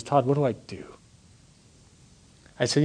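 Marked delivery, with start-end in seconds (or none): 0.0-0.8 breathy whisper of a broken friend; hushed, tearful plea; retold tearful whisper. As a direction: whisper it like he's too choked up to speak. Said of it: "and he's crying".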